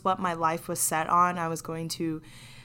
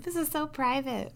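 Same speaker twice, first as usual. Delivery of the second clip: mock secretive; 7 semitones higher than usual. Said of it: "Me being like".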